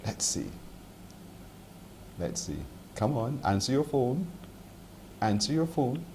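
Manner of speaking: quietly will the caller to pick up; hushed urging. Real, not acted.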